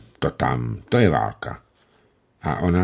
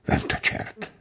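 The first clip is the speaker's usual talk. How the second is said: muttered curse; 10 dB quieter than usual. Acted. Letter writer cursing to himself.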